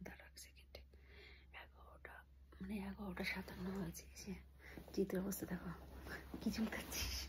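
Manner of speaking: hushed whisper at night. Real, not acted. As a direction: whisper so nobody wakes. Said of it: "আমরা আস্তে আস্তে কথা বলছি".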